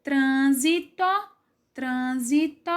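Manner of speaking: trânsito in even parts, trân-si-to, rising at the end; syllable by syllable. Shown as a demonstration. Teacher separating trânsito into parts, syllable by syllable. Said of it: "forem separar a sílaba".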